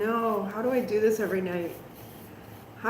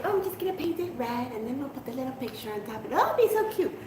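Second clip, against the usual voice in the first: mock naive optimism; 6 dB quieter, faster than usual. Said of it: "It's like".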